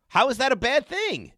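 high, drawn-out incredulous question; slow, high-pitched disbelief; indignant. Real, not acted. Host defends his sympathetic remark.

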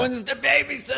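put-on high one-liner in German accent. Showing the sketch's one-line rhythm, German accent. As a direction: pitch it high, mock-German. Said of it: "doing your German accent".

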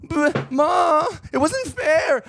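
high, pleading whine to Mom; whiny kid. Pretend. Child whining to Ma that it was unfair. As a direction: protest like a kid who got caught.

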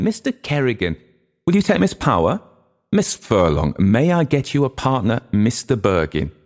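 high, eager hostess voice; high-voiced hostess pairing up dancers; busy young hostess. A narrator's reading. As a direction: greet the arrivals in a high, brisk feminine voice. Mary Jane pairs up dancers.

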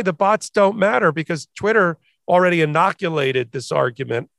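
ordinary talk, nothing marked

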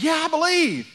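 high, loud ringing yes; loud eager answer. Retold or imitated. The man answering Jesus, loud and high. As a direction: shout back the answer high. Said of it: "And the guy said".

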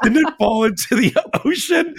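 high, cracking up while repeating the question; laughing mid-quote. Retold or imitated. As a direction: break into laughter on ocean.

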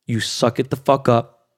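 ordinary talk, nothing marked

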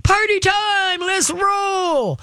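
dog hyped up by praise, shouting; giddy dog voice. Retold or imitated. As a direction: voice the dog gone nutsy with excitement. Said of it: "It's like".

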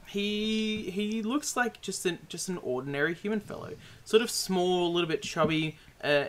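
normal talking voice

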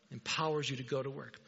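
ordinary delivery

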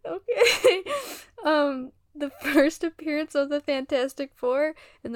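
giggling through the words; laughing. Real, not acted. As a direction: still laughing while reading. Laughing after vampire bit.

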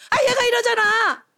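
high, exasperated whine of complaint; persistent nagging. Acted. Grandmother ignoring grandchild's protest, persistent nagging. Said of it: "계속 말해요".

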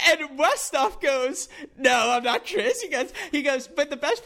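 can't stop laughing mid-story. Real, not acted. Telling the Westhoff mix-up, giggling through it.

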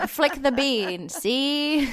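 put-on old Hollywood transatlantic accent, see; fast-talking old-movie transatlantic style. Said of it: "transatlantic accent".

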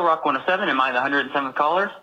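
normal talking voice

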